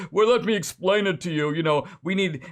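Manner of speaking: chuckling mock voice of a Democrat explaining to the public; mock condescending. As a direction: lecture them from your high chair, mockingly. Mocking condescending Democrats in high chair.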